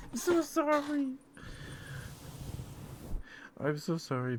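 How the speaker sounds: giggling sorry to the pet; a laughing, squealing apology. Real, not acted.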